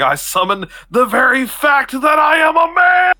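hammed-up battle cry, high and shouted; Dennis. Player picturing himself before the punching bag, hammed-up high shout. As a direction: shout it like a mock-heroic summoning. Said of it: "I just picture myself as Dennis".

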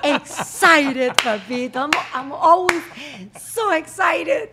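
hammed-up overexcited American, slowed, a laugh breaking in; gushing. Mock excitement teasing a guest over a translation slip. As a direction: overdo the thrill like a parody.

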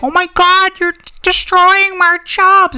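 high-pitched mock outrage, loud and overblown; pompous voice actor. Voice actor mocking peers upset about celebrity casting, high mock wail. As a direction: wail it as a hammed-up, offended voice actor. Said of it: "I'm not one of those, like, pompous voice act".

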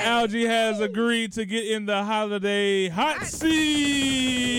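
slow, loud, high host intro; booming kids show opener. Acted. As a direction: present the contestant grandly. Announcing the contestant.

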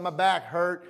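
mock complaint. Mimics people complaining of age.

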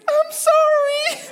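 very high, drawn-out mock wail; loud, slow, hammed-up cry; terrified child. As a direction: squeal a panicked apology like a scared kid. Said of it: "he's terrified".

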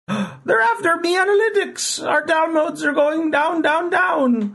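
high leprechaun brogue, "me analytics" for my; gasp, then a hammy Irish leprechaun whine; mock Irish. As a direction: leprechaun host wailing in a bad Irish accent. Leprechaun complains about Irish stats.

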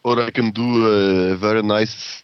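careful, heavy Russian accent. Shown as a demonstration. Streamer offering friends another voice, Russian accent. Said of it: "some Russian stylings for you".

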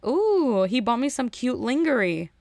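high-swooping mock cooing girl; imitated contestant. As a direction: coo it like a thrilled contestant, mockingly. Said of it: "the girl was like".

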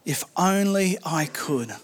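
soft, slow, aching sigh of a wish; wistful longing. Shown as a demonstration. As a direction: let the wish hang, quiet and slow. Pastor voices David's longing.

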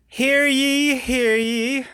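loud, high proclaiming call; town crier. Acted. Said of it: "I am a representative from Madison's Carnival".

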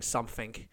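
"something" said as "sumfing". Shown as a demonstration. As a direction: swap the TH in "something" for an F. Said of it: "Maybe I should start saying something".

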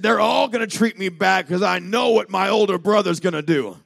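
high, loud acted protest; agitated outburst. Prodigal son expecting bad treatment, loud agitation. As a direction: cry it out at preaching force.

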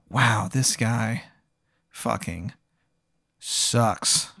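slow, soft verdict with long pauses; slow, understated contempt; quiet disdain. Acted. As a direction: let each piece drop softly, as a private thought. Inner verdict on pathetic prisoner.

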